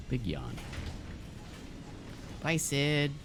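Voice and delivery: ordinary talk, nothing marked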